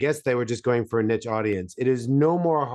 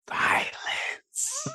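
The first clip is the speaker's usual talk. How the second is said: high breathy whisper; 5 dB quieter than usual. Acted. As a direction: whisper it spookily. Whispered joke rating.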